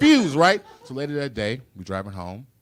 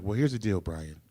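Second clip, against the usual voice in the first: sweet mom; 4 semitones lower, 7 dB quieter than usual. Retold or imitated. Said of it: "so innocent, so sweet".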